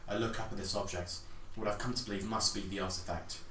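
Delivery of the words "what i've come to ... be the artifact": usual speaking voice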